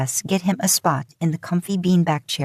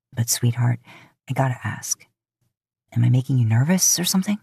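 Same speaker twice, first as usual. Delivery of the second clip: flirty Dean; 4 semitones lower than usual. A narrator's reading. Dean flirtily asking his date if she's nervous. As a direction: lower man's voice, quicker at the end.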